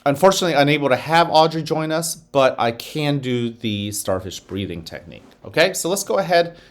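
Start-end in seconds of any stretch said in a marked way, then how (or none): none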